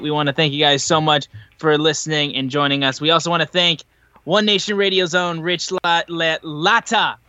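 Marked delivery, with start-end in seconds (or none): 5.4-7.2 tripping over the name, slowly; stumbling restart on the name; stammering. Real, not acted. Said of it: "I'm fucking stammering all over myself".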